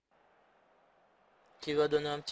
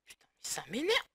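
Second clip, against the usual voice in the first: hissed under the breath, irritated; annoyed whisper; 7 semitones higher, 3 dB quieter than usual. Real, not acted. Gamer irritated by a bug, whispered curse.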